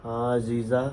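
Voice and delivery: soft slow demo. Shown as a demonstration. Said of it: "अगर बिल्कुल ऐसे बोल".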